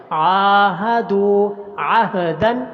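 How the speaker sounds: very slow, chanted recitation; careful tajweed chant; slow Quran recitation. Shown as a demonstration. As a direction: draw out the doubled letters in the chant. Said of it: "عین کو لمبا کرنا ہے".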